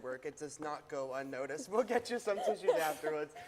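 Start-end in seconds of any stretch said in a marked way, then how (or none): 1.7-3.3 chuckling through a teasing line; laughing. Real, not acted. Anchor teasing her teary co-host, laughing. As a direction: laugh while you offer her tissues.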